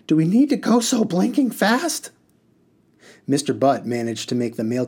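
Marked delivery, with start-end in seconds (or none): 0.1-2.1 high, raised storyteller's cry; cried out. A narrator's reading. Rashid crying out to the speeding driver, high and raised. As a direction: call out in alarm to the driver. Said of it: "cried out".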